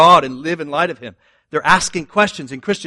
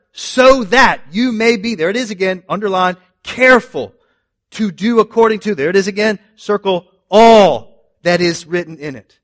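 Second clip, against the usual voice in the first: higher and louder, building preaching read; emphatic scripture reading; 5 semitones higher, 6 dB louder than usual. Acted. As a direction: preach the verse with rising force. Reading scripture with rising force.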